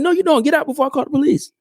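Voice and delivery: high, rushed mock threat. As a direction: mimic an outraged landlord, very high and fast. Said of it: "He was like".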